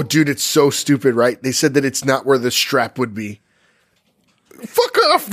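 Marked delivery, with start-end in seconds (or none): 4.7-5.3 high-pitched exasperated cry; indignant outburst. Real, not acted. Podcaster scoffing at the stupid strap argument, high indignant outburst. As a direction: pitch leaps up in disbelief.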